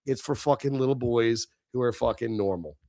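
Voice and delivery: ordinary delivery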